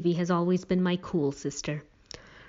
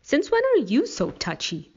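much higher, needling comeback; defensive; 9 semitones higher than usual. A narrator's reading. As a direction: turn it back on them, pitch up high. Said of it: "Stevie defended herself".

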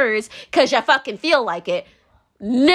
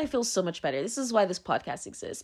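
much softer, low; soft confession; 4 semitones lower, 10 dB quieter than usual. Real, not acted. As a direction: say it quietly to yourself. Quiet confession.

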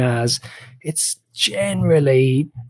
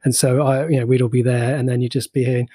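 ordinary delivery

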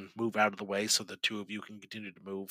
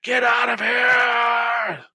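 high, frantic repeated screams, last one stretched out; full-force high shriek to drive them away; screaming; 13 semitones higher, 7 dB louder, slower than usual. Acted. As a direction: scream it over and over. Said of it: "He just keeps screaming".

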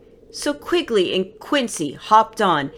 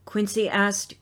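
ordinary delivery, nothing marked

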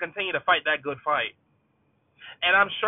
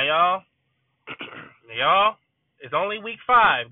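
ordinary talk, nothing marked

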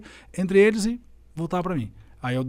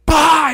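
loud, high "pá!" like a slam on the board; eraser slam; 11 semitones higher, 9 dB louder than usual. Retold or imitated. Teacher imitating the eraser hitting the board, loud bang. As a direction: smack the sound out loud. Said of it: "dei no quadro assim".

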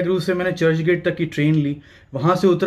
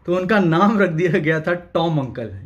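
ordinary delivery

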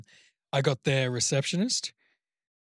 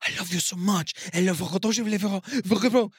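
pleading Frenchwoman, pitched up; mock desperate French lover, building higher; desperate French accent; 5 semitones higher than usual. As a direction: beg in a hammed-up French accent. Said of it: "hearing the accent out of desperation".

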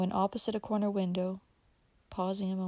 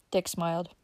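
plain reading voice

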